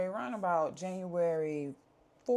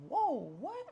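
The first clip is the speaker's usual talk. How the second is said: retold startled cry, much higher; shocked; 12 semitones higher than usual. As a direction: act out the sudden shock. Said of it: "I'm just like".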